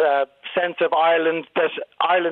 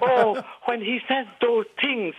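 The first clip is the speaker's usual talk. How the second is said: slow, breathy, one beat at a time stage-Irish; airy fake Irish; 7 semitones higher, slower than usual. Shown as a demonstration. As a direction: overdo the air in a put-on Irish accent. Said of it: "one beat at a time and there's lots of air".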